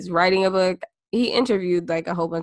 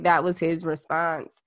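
ordinary talk, nothing marked

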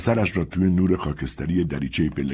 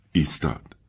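ordinary delivery

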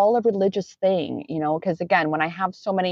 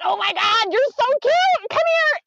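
gushing at cute bunny. Retold or imitated. Coaxing the cute dark rabbit closer with a gushing coo. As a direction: squeal over something adorable.

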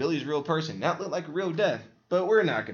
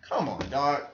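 ordinary delivery

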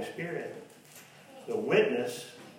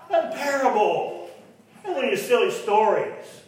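fiery preaching; 8 semitones higher, 6 dB louder than usual. Acted. Preaching at full force.

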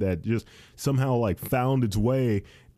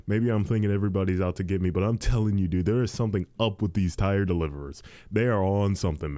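usual speaking voice

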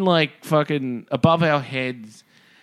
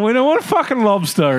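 exasperated refusal; 12 semitones higher, 5 dB louder than usual. Retold or imitated. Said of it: "we're going".

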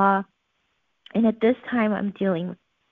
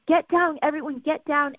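retold yell; 6 semitones higher than usual. Mother yelling during the shooting.

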